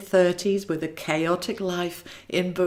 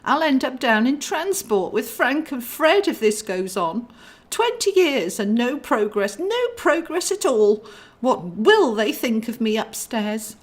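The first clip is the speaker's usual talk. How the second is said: spiral into panic; panicky angel; 7 semitones higher than usual. Acted.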